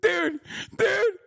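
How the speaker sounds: very high, cracking with laughter; disbelieving laugh-cry; laughing in disbelief. Real, not acted. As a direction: lose it while calling out dude. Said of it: "overwhelmed by laughter".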